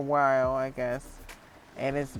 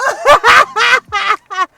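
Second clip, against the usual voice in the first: high, loud burst of laughter; cracking up at the joke; 18 dB louder than usual. Real, not acted. Laughing at own impression.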